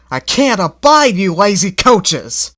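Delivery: loud, exasperated scolding; fed up with lazy coaches. A narrator's reading. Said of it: "with great feeling".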